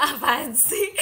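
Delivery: giggling through the question, slowed; laughing. Real, not acted. Laughing at a viewer's cheesy pickup line. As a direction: laugh out the words in embarrassment.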